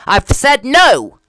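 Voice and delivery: NO drawn out, high and loud, very slow; emphatic no. Real, not acted. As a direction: lean hard on no and stretch it. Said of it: "I've said no emphatically".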